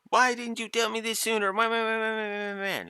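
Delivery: very high, hammed-up whiny complaint trailing into babble; mock drama. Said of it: "there's no drama of".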